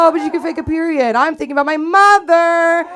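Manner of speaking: high, loud mock-outraged rant; indignant outburst. Homesick camper rants about the girl faking it, indignant. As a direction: blow up in comic indignation, climbing to a yell.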